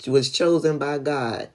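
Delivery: ordinary talk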